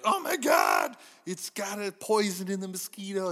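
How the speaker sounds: high, loud alarmed cry; hammed-up movie character in alarm; mock panic. Acting out film characters' panic.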